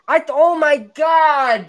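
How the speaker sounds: ordinary talk, nothing marked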